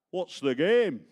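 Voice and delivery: high, loud mock-conciliatory Yorkshire baron; baron's challenge. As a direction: fling the question up high through the helmet in Yorkshire. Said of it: "conciliatory like".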